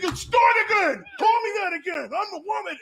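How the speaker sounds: high-pitched comic outrage; mock mad. Customer warning the clerk who said sir, mock mad. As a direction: imitate a customer working up a tantrum. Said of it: "stormed out of there mad and strong".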